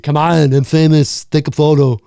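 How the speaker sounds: a bad, loud Austrian Arnold; Arnold impression. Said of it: "I can't do an Arnold impression".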